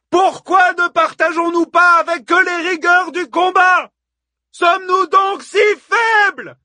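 high, loud challenge to a crowd; yelling. A narrator's reading. Hippodamie challenges the women of Troy, yelling. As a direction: shout the questions like a rabble-rouser. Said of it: "hurla Hippodamie".

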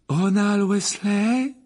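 teacher calling a pupil from above, slow and loud; misty seer calling. A narrator's reading. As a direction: call the next pupil up, drawn out. Trelawney calls down.

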